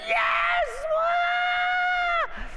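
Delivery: very high shout of delight, yes then wah; eager cheer. Shown as a demonstration. Pastor acting out eager desire for the money, a high joyful shout. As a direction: yell it like you just grabbed the money. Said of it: "you would be like".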